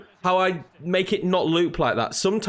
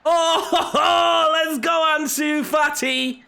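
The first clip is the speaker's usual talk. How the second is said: excited goal shout; 11 semitones higher, much slower than usual. Real, not acted. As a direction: scream the goal out, thrilled. Goal scored in football game.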